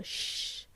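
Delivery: "sh" as a voiceless hush, very soft; just hiss a long soft "sh", no vowel. Shown as a demonstration. Teacher correcting learners, soft sh. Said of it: "pronounced like a soft sh".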